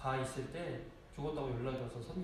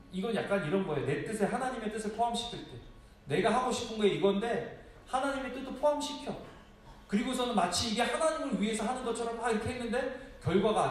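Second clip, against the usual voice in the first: preaching at full force; 7 semitones higher, 5 dB louder than usual. Acted. Preaching point raised.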